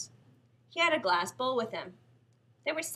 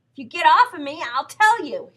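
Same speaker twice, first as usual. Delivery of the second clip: pinned-down protest; 6 semitones higher, 11 dB louder than usual. Acted.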